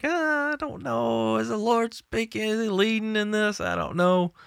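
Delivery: waver aloud in a high, quiet, slowed voice; uncertain doubter. Acted. Preacher mimicking believers unsure of the Lord's leading, high hesitant voice.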